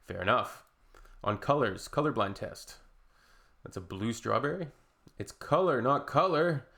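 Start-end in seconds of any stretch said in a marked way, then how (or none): none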